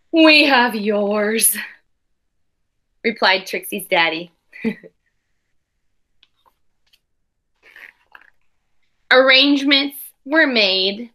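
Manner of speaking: put-on expressive read-aloud for children; storybook reading voice; story narration. A narrator's reading. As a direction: read the picture book to the class with care. Teacher reads picture book aloud.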